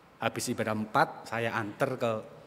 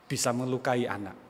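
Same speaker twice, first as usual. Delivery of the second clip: ordinary talk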